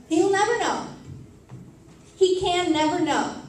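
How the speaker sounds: pained, loud declaration; anguished cry. Acted. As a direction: cry it out in despair. Poem climax, anguished realisation.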